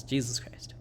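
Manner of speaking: breathy hushed whisper; whispered curse. Acted. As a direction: mutter it under your breath, voiceless. Old man swears under breath.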